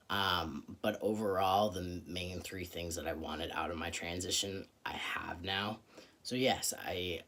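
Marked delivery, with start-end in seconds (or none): none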